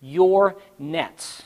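extremely drawn-out delivery; solemn command. Retold or imitated. Preacher quoting God's command, slow and deliberate. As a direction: linger on every word. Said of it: "When he said".

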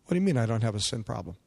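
low, soft, fast imitation; disbelieving objector. People shaking their head at the news, disbelieving. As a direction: mutter a quick mock protest. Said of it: "look at you and shake their head".

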